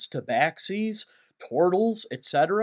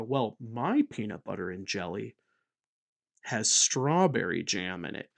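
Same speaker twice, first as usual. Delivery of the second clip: smug designer; 7 semitones lower, 7 dB quieter than usual. Retold or imitated. Rival designers boasting about their sandwich twist, low and snooty. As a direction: play a self-satisfied designer. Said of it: "where they go".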